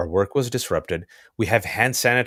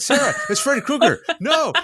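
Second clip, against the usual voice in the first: a hammed-up high yelp at naive Sarah; mock panic. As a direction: play himself as the frantic one. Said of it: "And I'm like".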